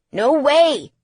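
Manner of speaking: loud, high-pitched protest; emphatic refusal. A narrator's reading. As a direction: refuse loudly and at once. Son refuses in a panicked argument.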